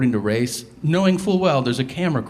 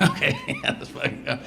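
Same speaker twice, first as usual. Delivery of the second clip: laughing mock eagerness; 4 dB quieter than usual.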